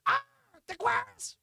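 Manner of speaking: high put-on voice; high-pitched acted comeback; mock woman's retort. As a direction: answer back in a high voice. Acted reply from other room.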